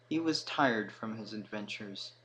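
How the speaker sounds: plain reading voice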